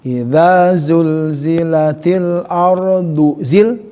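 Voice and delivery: "ardu zil-" run together slowly; recitation, joined ardu zil. Shown as a demonstration. Teacher demonstrating the joined duzil reading. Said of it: "Duzil boleh gitu kan".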